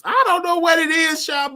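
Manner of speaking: high, loud excited shout to Sean. Real, not acted. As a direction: call out to Sean, cracking up. Laughing at the Bongos mix-up.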